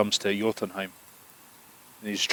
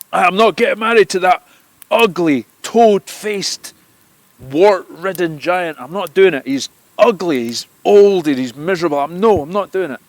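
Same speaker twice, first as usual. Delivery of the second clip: high, loud outraged shout; furious refusal; 9 semitones higher, 11 dB louder than usual. Acted. Freya to Loki, refusing the marriage with a high furious shout. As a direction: rage against the ugly giant.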